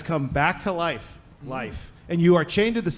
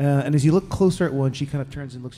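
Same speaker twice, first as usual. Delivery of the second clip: normal talking voice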